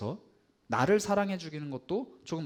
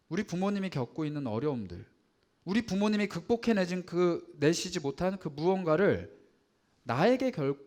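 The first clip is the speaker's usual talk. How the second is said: normal talking voice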